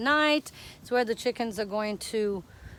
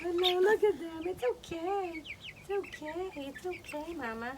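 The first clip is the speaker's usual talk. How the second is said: higher, fading soft reassurance; soothing mother hen; 6 semitones higher, 5 dB quieter than usual. Real, not acted. Owner softly calming the upset mama hen. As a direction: hush and calm the mama hen.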